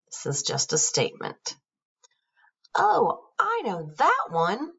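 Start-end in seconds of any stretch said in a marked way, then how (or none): 2.8-4.7 high, eager burst of knowing; excited student calling out; excited cry. Acted. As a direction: blurt it out like the eager kid who knows the answer. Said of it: "cried Nick excitedly".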